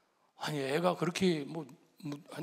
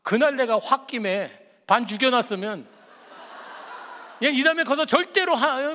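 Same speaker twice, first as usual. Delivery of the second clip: laughing through the words, pitched up and loud; half-laugh, half-confession, slower; 5 semitones higher, 7 dB louder than usual. Real, not acted. Pastor recalling his anger, laughing in relief.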